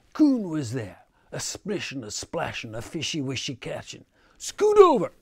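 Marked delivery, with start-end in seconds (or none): 4.4-5.1 very high, very loud bark; snarled order. A narrator's reading. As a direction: bark at them to move over. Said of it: "snarled he".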